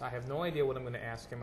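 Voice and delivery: ordinary talk, nothing marked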